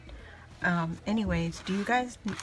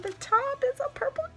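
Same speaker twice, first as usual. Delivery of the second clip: squealing excitement; 14 semitones higher than usual. Real, not acted. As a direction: squeal with real overjoyed excitement. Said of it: "I'm so excited".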